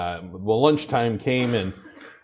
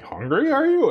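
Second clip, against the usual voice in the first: teasing coworker; 14 semitones higher than usual. Retold or imitated. Coworkers teasing about big bag.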